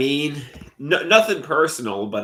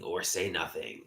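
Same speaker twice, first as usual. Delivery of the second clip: much softer, lower echo; 6 semitones lower, 10 dB quieter than usual. Real, not acted. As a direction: let it fall away. Repeated, second fades.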